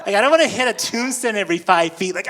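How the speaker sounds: usual speaking voice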